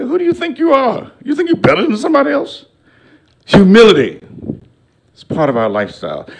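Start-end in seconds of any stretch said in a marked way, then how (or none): 0.0-2.7 high mocking voice, the second line faster; mocking hometown scold.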